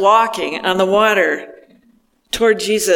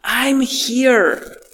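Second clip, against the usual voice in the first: tender reassurance; 3 semitones higher, slower than usual. Retold or imitated. Jesus catching sinking Peter, gentle and soothing. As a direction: hold him up and soothe him. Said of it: "the feeling is the same".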